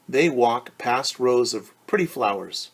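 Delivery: normal talking voice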